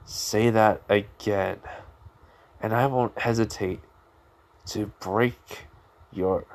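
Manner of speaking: slow, halting threat with long pauses; menacing threat. Acted. Boy threatening to break his rival's arms, slow menace. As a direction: space out each phrase like a cold warning.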